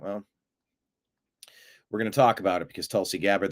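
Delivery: ordinary talk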